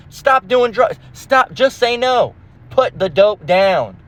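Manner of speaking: high sarcastic scold; mock scolding. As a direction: scold them in a fake high tone. Mocking advice to addicts.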